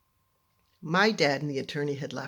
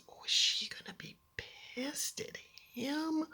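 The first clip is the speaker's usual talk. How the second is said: whispered worry; 11 semitones higher, 8 dB quieter than usual. Retold or imitated. Kid imagining mom's anger.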